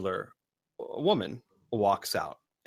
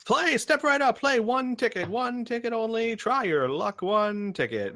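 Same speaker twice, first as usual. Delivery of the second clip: high sing-out pitch of a stallholder; carnival barker shout; 12 semitones higher, 3 dB louder than usual. Acted. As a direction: call out to passers-by like a fairground barker. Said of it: "they all shout out".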